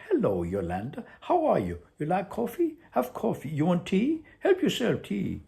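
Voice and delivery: put-on a touch of teasing Duncan in a Colombian accent; mock Duncan. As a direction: lightly mimic his chirpy offers. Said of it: "You say".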